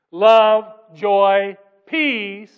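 Love, joy, peace, each word loud and separate; emphatic list. Real, not acted. Preacher repeating the fruits of the Spirit, emphatic. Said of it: "But the fruit of the Spirit is love".

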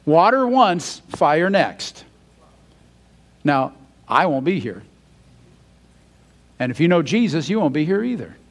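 usual speaking voice

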